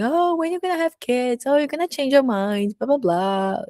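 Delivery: mock nagging. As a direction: parody the people pestering her.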